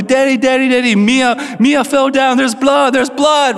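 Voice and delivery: high, imitated panicky repeats; freaking-out child. Son telling father his sister fell, imitated panic. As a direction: cry for Daddy like an alarmed little boy. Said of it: "He's freaking out".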